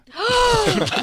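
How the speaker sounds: sharp high gasp of shock. Real, not acted. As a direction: suck in air in sudden alarm.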